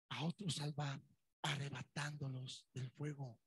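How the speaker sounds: hushed scripture echo; soft repeat. Shown as a demonstration. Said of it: "repeated quietly".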